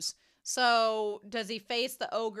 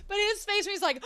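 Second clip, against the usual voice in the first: ordinary delivery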